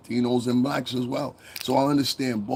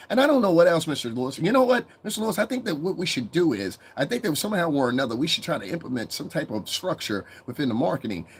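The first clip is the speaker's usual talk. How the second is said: higher, formal underling voice; over-articulate help; 6 semitones higher than usual. Acted. The help advising Mister Lewis, eager and over-articulate. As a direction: pitch an idea to the boss, over-polite and wordy. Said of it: "The help talks like".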